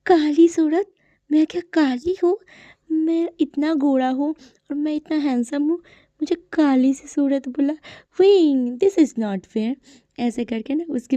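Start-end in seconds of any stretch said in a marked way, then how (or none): 0.1-9.7 indignant disbelief. Retold or imitated. Wangji, called dark-faced by Wing, reacts in high indignant outrage. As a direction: squawk it like a vain man insulted. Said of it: "लान वांगजी सोचता है".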